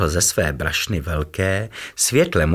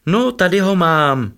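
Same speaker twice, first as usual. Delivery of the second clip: higher and louder, hesitant; shy; 11 semitones higher, 5 dB louder than usual. A narrator's reading. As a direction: offer it shyly. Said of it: "řekl nesměle". (nesměle, výš a hlasitěji)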